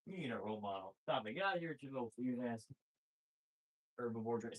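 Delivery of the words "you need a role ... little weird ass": normal talking voice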